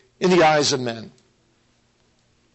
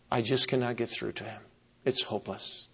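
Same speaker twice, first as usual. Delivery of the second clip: hopeless; 7 semitones lower, 11 dB quieter than usual. Retold or imitated. Despairing deacon retold to preacher.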